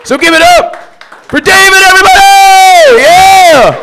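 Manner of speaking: high, shouted stage announcement; rousing full-voice hype; emcee introduction. Real, not acted. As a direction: belt out the name to bring on the act. Host introduces the next comedian.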